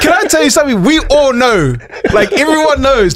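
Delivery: high, animated call-out; excited reveal. Real, not acted. Host calling out stingy friends, high and excited. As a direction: let them know everyone knows.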